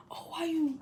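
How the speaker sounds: hushed, breathy whisper of the brother. Retold or imitated. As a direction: whisper the brother's line, barely voiced. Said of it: "yeah, like that".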